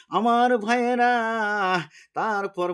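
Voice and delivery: loud, high preacher's call; soaring sermon address; calling the congregation. Real, not acted. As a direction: ring it out to the brothers in the crowd.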